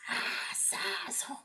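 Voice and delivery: put-on whispered Mexican homie accent; hushed mock-gangster Mexican drawl; Mexican gang accent. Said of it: "talk like I'm in a gang".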